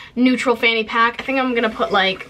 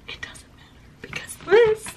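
higher, whispered confession; breathy whisper aside; 10 semitones higher than usual. Real, not acted. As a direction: whisper it like a secret. Dramatic aside about the bag.

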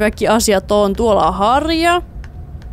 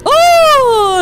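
loud, high, long "ohh!"; startled cry; 13 semitones higher, 5 dB louder than usual. Real, not acted. Player startled by Mama coming too near, high loud cry. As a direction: cry out as something lunges close.

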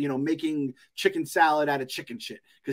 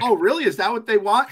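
mock studio exec; 5 semitones higher, 4 dB louder than usual. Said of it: "Kathleen Kennedy's gonna, like, be like".

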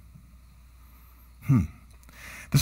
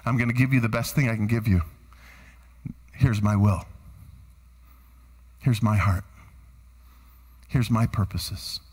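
God as father; 10 semitones lower than usual. Acted. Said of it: "what he says to me is".

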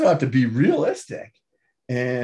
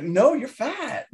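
a mock blunt mother, pitched well higher; imitating mom bluntly, voice raised high; 9 semitones higher than usual. Mom bluntly telling her son he's fat, higher mock blunt voice. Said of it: "my mom would be like".

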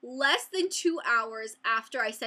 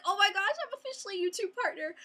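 high, rushed excited squeal; thrilled; 9 semitones higher, faster than usual. Retold or imitated. Vlogger reliving the congratulations email, thrilled squeal. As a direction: gush it in a giddy rush.

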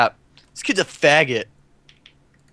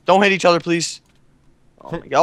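usual speaking voice

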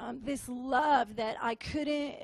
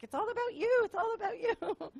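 much higher, light, airy repeated phrase; voicing all of them, much higher; high sing-song chorus; 9 semitones higher, 3 dB quieter, faster than usual. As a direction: lift it high like a crowd of adoring voices. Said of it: "all of them are like".

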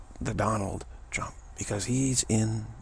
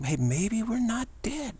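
hopeful Trump; 9 semitones higher than usual. Retold or imitated. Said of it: "he's bringing this thought".

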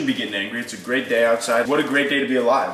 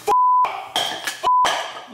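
shrieked curse. Real, not acted. Lost his fork.

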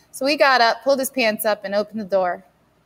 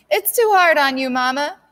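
crying out; 6 semitones higher, 5 dB louder than usual. Acted. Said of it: "never seen Sassy cry so fast".

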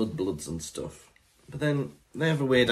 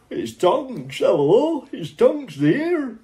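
higher, louder, slow northern Tonks voice; mock northern accent; 10 semitones higher, 6 dB louder, much slower than usual. Co-host mimics the audiobook's northern Tonks, hammed up. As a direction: ham up a northern greeting. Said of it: "giving her a northern accent".

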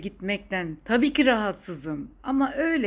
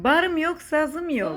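put-on higher, louder, a playful southern Turkish accent; put-on regional Turkish accent; 6 semitones higher, 5 dB louder, slower than usual. Joking with her daughter about her hobbies in a southern Turkish accent. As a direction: lay on a southern Turkish twang for fun. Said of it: "Sana bu şive nereden yüklendi?".

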